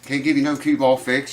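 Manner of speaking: ordinary delivery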